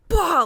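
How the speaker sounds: a sudden loud, high "bah" like a blow landing; mock strike sound. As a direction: bark out one sharp "bah" as you hit him. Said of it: "And I just went".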